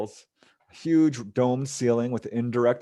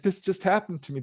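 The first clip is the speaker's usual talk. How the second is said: first take pitched much higher and urgent; frantic telling; 9 semitones higher than usual. Retold or imitated. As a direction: blurt it out in a panic, voice jumping up. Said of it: "And she's like".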